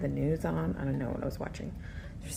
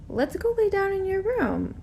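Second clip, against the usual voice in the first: put-on higher, sweet coaxing voice to a toddler; coaxing sing-song; 4 dB louder than usual. Babysitter coaxing a three-year-old to bed, high and sweet. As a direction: lure a little girl to bed sweetly.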